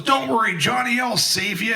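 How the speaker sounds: lightly voiced big brother playing hero; brother's rescue call. Brother calling from the stern rail, mock heroic. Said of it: "and he goes".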